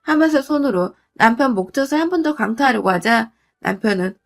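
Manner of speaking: ordinary delivery, nothing marked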